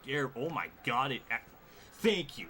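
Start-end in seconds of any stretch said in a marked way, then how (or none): 1.9-2.5 a loud, higher burst of relief; relieved thanks. Real, not acted. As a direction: thank the sky it's finally over. Relief after beating challenge.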